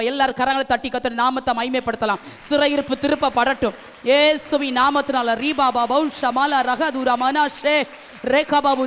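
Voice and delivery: fervent, booming prayer breaking into tongues; full-force deliverance prayer. Real, not acted. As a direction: preach it at full volume, ordering captivity to turn back.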